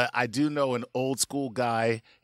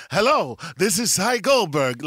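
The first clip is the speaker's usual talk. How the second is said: slow, loud, hammed-up phone greeting; 6 semitones higher, 6 dB louder than usual. Shown as a demonstration. [name] showing the fake Cy voice he avoided, hammed up. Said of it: "I wouldn't do the whole".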